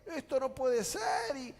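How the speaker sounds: high, loud whining complaint; complaining. Pretend. Believer complaining about God, high and loud. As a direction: grumble about every injustice. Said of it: "Cuando uno empieza a decir".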